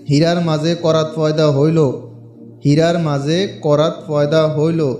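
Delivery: slow, deliberate chant for learners to copy; careful mantra recitation. Shown as a demonstration. Teaching viewers the exact pronunciation, slow deliberate chant. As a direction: spell it out line by line as a model. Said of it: "আমি যেভাবে উচ্চারণ করি ঠিক এভাবে উচ্চারণ করতে হবে".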